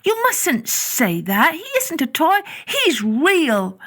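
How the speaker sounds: put-on high, earnest child voice, slow start; a small boy insisting his bunny is real; boy protesting. Boy correcting Nana, earnest and high. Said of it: "he said".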